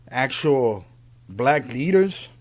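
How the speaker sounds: ordinary delivery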